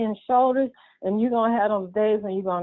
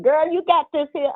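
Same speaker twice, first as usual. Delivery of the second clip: put-on higher, encouraging girl you got this here; self pep talk; 7 semitones higher than usual. Telling herself on hard days, upbeat pep talk. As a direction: cheer yourself on. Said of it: "that's what I tell myself".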